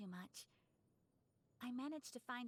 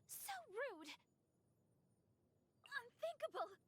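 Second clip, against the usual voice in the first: much higher shocked exclamation; indignant cry; 12 semitones higher, slower than usual. Acted. Swordswoman takes a hit, indignant high cry. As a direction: yelp it as if struck.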